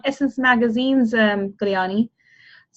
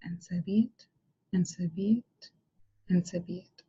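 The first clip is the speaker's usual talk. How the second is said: soft whispered incantation, rising on be; whispered affirmation; 3 semitones lower, 12 dB quieter than usual. Acted. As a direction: seal the vision in a hush. Closing affirmation of the visualization.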